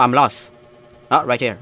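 ordinary delivery

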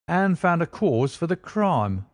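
a self-satisfied detective gloating a little; smug inspector. Acted. Lestrade's triumphant reveal.

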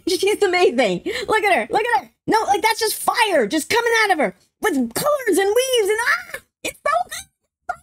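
high-pitched, giddy fangirl rave; overwhelmed, squealing delight; excited gushing. Real, not acted. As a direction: gush with rising pitch until it turns squeaky. Gushing over the explosion shot.

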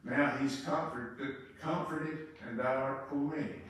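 low, soft, grave patriarch; quiet deep stern rebuke; Abraham's voice. Acted. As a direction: speak low and solemn as Father Abraham. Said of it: "Abraham told him".

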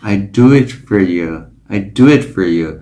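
"for" reduced to a weak fer; unstressed "for" flowing into "you". Shown as a demonstration. Teacher demonstrating the reduced "for": weak fer. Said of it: "dạng giảm lược của cái từ for là for".

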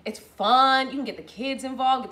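ordinary delivery

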